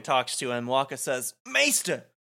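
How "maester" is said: high, urgent acted plea. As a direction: call out in dismay to your superior. Wakka pleads with Seymour in script.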